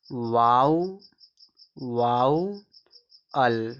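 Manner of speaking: "वाउ", then "वाउअल", hugely stretched; syllable-by-syllable demo. Shown as a demonstration. Teacher joins the parts for students, very slow "वाउअल". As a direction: draw out vau, then add al: "वाउअल". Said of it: "इसको पढ़ेंगे".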